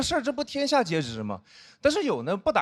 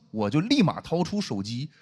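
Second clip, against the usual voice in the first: normal talking voice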